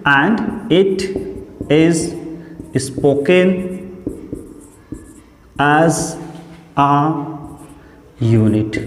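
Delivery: slow, word-by-word dictation; dictation pace. Shown as a demonstration. Teacher dictating the definition word by word. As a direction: pause after every word so they can write. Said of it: "तो ये क्या होता है?".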